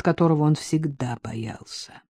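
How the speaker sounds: usual speaking voice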